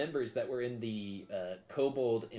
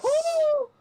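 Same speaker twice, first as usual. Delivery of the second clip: loud high whoop of joy; cheer; 12 dB louder than usual. Real, not acted. Player cheers success.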